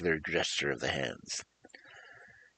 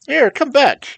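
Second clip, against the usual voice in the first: high, loud panicked cry; frightened cry; 15 dB louder, slower than usual. Acted. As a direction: shout it after something flying away in fear. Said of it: "cried Tip in a frightened voice".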